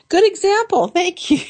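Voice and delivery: high laughing voice. Real, not acted. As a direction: talk through a giggle. Laughing after tea remark.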